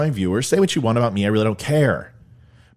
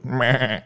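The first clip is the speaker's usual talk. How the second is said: short imitated goat noise; goat bleat. Host punning on "gets their goat", comic bleat.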